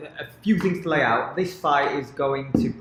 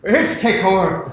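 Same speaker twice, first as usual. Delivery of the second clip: mock Irish accent; 6 semitones higher, 10 dB louder than usual. Mock voice joke after Irish attempt.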